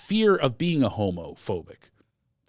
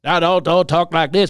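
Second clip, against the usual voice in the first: light Southern accent, a bit louder; 4 semitones higher, 5 dB louder than usual. Shown as a demonstration. A comic showing how some Southerners talk, light Southern accent. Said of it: "if I do a Southern accent".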